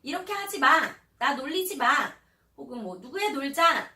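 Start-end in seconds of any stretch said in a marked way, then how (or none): 0.0-1.9 loud, much higher, firm; shouted protest. Shown as a demonstration. Child practising standing up to teasers, loud shout. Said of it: "크게 외쳐보는 거예요". 3.0-3.9 loud, high call to a friend; shouted slogan. Shown as a demonstration. Child practising calling a friend to play, shouting. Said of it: "큰 소리로 한 세 번씩".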